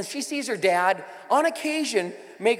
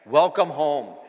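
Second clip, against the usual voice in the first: low settled welcome; 5 semitones lower, 3 dB louder, slower than usual. Shown as a demonstration. Repeating the welcome to newcomers, low and falling. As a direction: Welcome home dropped low with a falling close. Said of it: "I'll say it again".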